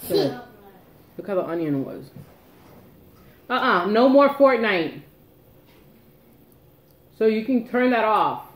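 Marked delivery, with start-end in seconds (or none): 3.5-8.4 firm, raised mom voice; stern scolding. Real, not acted. Mom scolding kid over Fortnite.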